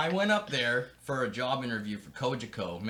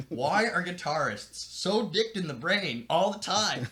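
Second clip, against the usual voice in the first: exasperated; 6 semitones higher, 4 dB louder, slower than usual. Retold or imitated. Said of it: "And I'm going".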